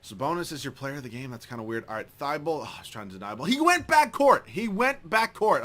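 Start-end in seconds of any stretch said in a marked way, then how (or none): 3.5-5.6 loud, high, slow drawn-out complaint, easing on the repeat; outraged protest. Real, not acted. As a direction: plead the call in slow outrage. Outrage at missed call.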